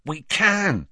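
high-pitched eager reply; excited. A narrator's reading. Ron telling Hermione they can listen in, excited. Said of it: "said Ron excitedly".

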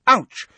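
high, loud "ouch"; sudden pained cry. A narrator's reading. Students poked by their telescopes, sudden pained cry. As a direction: yelp it as if poked. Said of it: "Several people cried".

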